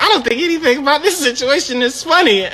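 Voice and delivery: high, fast offended-customer impression; mock indignant caller. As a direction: scold in her high voice, half laughing. Mimicking caller annoyed at his giggling.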